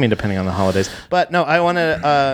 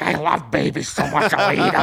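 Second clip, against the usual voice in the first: slowed, eerie Gollum-ish coo. Retold or imitated. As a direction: croon it like a creepy creature. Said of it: "They turn into Gollum".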